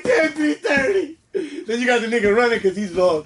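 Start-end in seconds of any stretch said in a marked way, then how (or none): 0.0-1.2 drawn-out high mock surprise; mock dismay. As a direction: stretch it slowly, acting shocked about the time.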